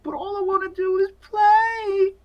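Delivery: put-on very high, plaintive child voice; small high-pitched boy begging to play; pleading little boy. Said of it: "And he's like".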